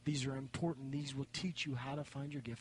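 normal talking voice

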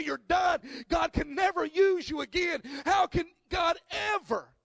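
loud, rising preacher's call to the congregation; preaching at full force. Acted. Pastor stirring up the church, preaching loud and full. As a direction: preach it out to the whole room.

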